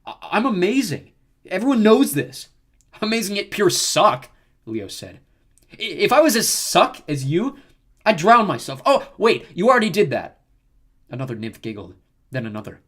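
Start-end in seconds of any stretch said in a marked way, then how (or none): 0.1-2.5 high, loud, stammering vanity; indignant protest. A narrator's reading. Narcissus stammers in offended protest. 3.0-4.3 high, loud, drawn-out jibe; mocking comeback. A narrator's reading. Leo mocks Narcissus. 5.7-10.4 high, loud roast; mocking insult joke. A narrator's reading. Leo's taunting joke.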